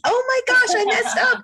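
high-pitched, real "oops" outburst; flustered realization. Real, not acted. Host botching the order of guests, flustered realization. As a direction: catch your own blunder, pitch shooting way up.

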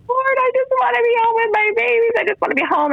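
retold sobbing prayer. Mom driving to night shift, begging God in a high sobbing plea. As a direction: plead as if sobbing behind the wheel. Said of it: "I would just drive to work just sobbing".

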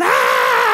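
loud mock baby scream; harsh, wordless imitated shriek; imitated toddler scream. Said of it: "is standing at the crib going".

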